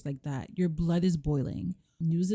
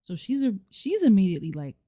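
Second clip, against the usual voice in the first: ordinary talk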